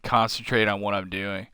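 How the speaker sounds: ordinary delivery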